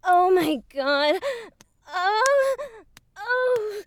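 high, breathy cries of pleasure, rising; pleasured moaning. Acted. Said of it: "Oh, that's so good".

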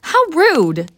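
high, offended exclamation; indignant. Acted. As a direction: act insulted, high and sharp. Offended demon snaps at summoner.

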